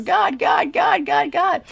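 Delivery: loud, high, repeated mock plea; hammed-up calling on God in distress; mock crying out. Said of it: "He's crying out to God".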